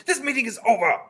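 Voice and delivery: very loud, high witch command; shouted. A narrator's reading. Grand High Witch closing the meeting, shouted. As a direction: shout it to dismiss the whole hall. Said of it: "she shouted".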